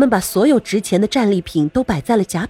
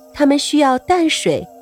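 usual speaking voice

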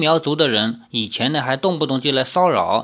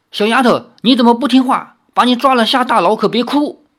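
raised, loud, high-pitched threat; fierce scolding shout; 7 semitones higher, 5 dB louder, faster than usual. A narrator's reading. Spy threatens the girl with prison, fierce shout. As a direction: bark it fiercely to frighten her off. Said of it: "神情更凶了，喝道".

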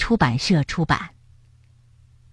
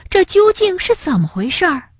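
startled question; 11 semitones higher than usual. A narrator's reading. Rabbit startled by the darkness.

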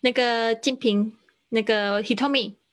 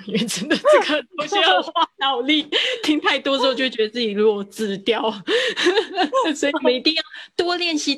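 laughing while talking; 5 semitones higher, 4 dB louder than usual. Real, not acted. Teacher jokes to the class about the price rise, laughing. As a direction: talk through laughter, higher and louder.

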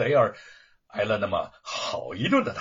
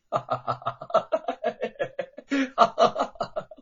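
long cackling villain laugh, high; wild mocking laugh; 10 semitones higher than usual. A narrator's reading. Luo Pang laughing at grieving Wu, wild laugh. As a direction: throw your head back and cackle. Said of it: "狂笑不已".

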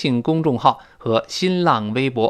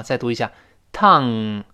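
ordinary delivery

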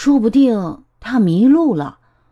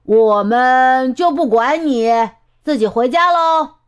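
calling a warning; 3 semitones higher, 4 dB louder than usual. Acted. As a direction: holler it, lifting the last words high. Emma calls a warning upward.

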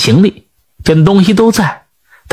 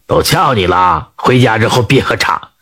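put-on old man's voice; 9 semitones lower than usual. A narrator's reading.

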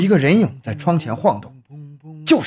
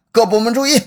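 calling out; 9 semitones higher, 4 dB louder than usual. Acted. Director calls the start.

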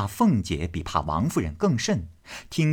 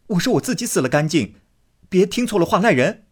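fast, high, loud; flustered protest; 10 semitones higher, 5 dB louder, faster than usual. Acted. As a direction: hurry to correct her. Baoyu protests.

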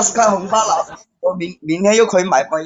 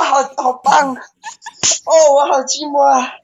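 higher-pitched mock-pleading cries; put-on moaning calls; 8 semitones higher than usual. Forfeit player pleading with viewers by nickname, hammed-up moaning. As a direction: wail each name with hammed-up longing.

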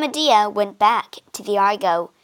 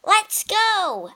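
eager call; 11 semitones higher than usual. Acted. Jason urges crew to sail.

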